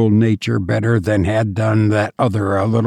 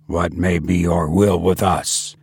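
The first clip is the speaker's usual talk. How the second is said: haughty and lower, proud; fine arrogance; 6 semitones lower than usual. A narrator's reading. The president answers the captain with arrogance. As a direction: look down on the intruder. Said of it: "a fine arrogance in his voice".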